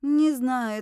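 usual speaking voice

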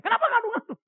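high, loud mock outburst; retold angry scolding. Son scolds his mother for telling on him, acted anger. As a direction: burst out as the indignant child you once were. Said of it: "saya marahin lagi mama saya".

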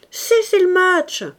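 loud, high-pitched cry; crowd's shout. A narrator's reading. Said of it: "cria l'assistance". (cri aigu et fort de la foule)

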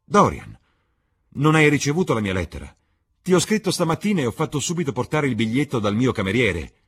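high, loud and fast, pressing; urgent Lord Henry. Acted. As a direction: hurry him to the bad news. Lord Henry urgent about letter. (Lord Henry incalzante, acuto, forte e rapido)

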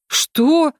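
startled, high-pitched near-cry; almost cry out, pitch jumping up; near shout. A narrator's reading. Girl shocked by her friend's decision, near shout. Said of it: "Чуть не вскричала я".

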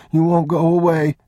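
put-on a frightened boy asking; anxious boy. Sick boy pleads.

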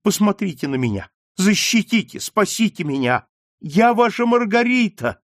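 high, breaking appeal; desperate plea. Acted. Daughter begging her father to protect her, rising high. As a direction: beg him, rising to a cry.

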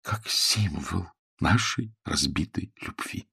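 very soft, slow and solemn; quiet voice. A narrator's reading. Woman explains the symbol of broken love, quietly. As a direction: spell it out slowly, hushed, word by word. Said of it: "проговорила она тихим голосом".

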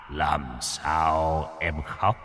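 put-on low, very slow, drawn-out old-sage voice; fairy-godfather Bụt. Bụt asking a crying child what's wrong, slow deep sage voice. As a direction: intone it like the kindly Bụt appearing. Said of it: "bụt sẽ phọt ra và hỏi".

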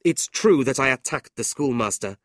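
loud, firm defiance; defiant young man. A narrator's reading. Nicholas defiantly defends himself to his family. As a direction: stand your ground.

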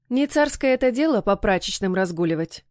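usual speaking voice

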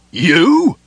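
high, loud shocked cry; shocked, shouted one-word question. A narrator's reading. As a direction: blurt it out in disbelief, loud. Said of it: "Connell was so shocked he shouted the word".

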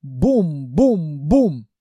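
slow, heavy stomping imitation; booming stomps. A narrator's reading. Narrator imitates the galloping armchair's paws, slow booming. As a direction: sound out each thud, drawn out.

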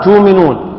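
تؤمنون read as تومنون; hamza changed to waw. Shown as a demonstration. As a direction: turn the hamza of تؤمنون into a long u. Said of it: "المناسب لها الواو".

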